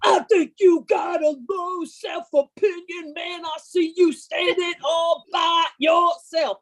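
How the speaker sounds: high, slow mock yelling at the viewer. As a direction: bellow it like a punk singer on TV, dragged out. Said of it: "he is screaming at me".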